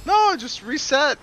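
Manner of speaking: high, loud, drawn-out protest; urgent call. Real, not acted. Player telling a teammate to reset, urgent call.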